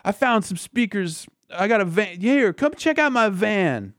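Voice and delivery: high-pitched whining surfer bro; Yamcha. Acted. Yamcha complains, then hawks speakers from his van, surfer-dude impression. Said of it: "doing a shitty Michelangelo impression".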